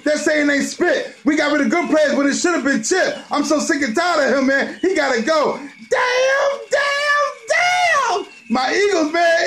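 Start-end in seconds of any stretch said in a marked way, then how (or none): 5.6-8.2 high wailing damns; anguished damn. Real, not acted. Fan wailing over the loss to Dallas. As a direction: cry out each one higher. Lament after home loss.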